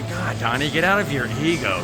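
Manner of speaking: ordinary delivery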